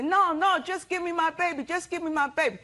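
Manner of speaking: high whining plea, mimicking Rachel; pleading baby back, whiny. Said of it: "Then Rachel would say".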